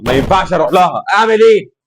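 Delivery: rising to a loud, high cry of despair; building from annoyed to exasperated; frustrated outburst. Real, not acted. Gamer stuck on the button, loud exasperated cry.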